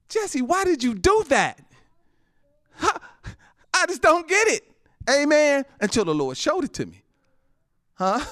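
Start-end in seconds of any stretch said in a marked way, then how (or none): 0.1-4.6 high, loud whiny question; mock complaining self. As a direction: act out his own past whining. Preacher retells his own complaint.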